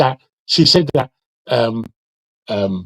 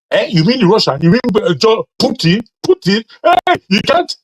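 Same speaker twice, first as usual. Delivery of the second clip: 1 mock auntie exchange; 8 semitones higher, 3 dB louder than usual.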